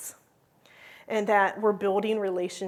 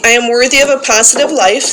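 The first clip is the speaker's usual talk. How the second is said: loud call-and-response recitation, each line declared for the group to repeat; loud group-leader recitation; leading a creed; 14 dB louder than usual. Acted. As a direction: proclaim it to the room like a rallying creed. Leads daily creed recitation.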